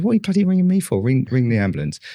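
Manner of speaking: high-pitched mock telling-off, imitating his wife; a wife's high exasperated scold, imitated. Retelling his wife's phone reply, a high mock scold.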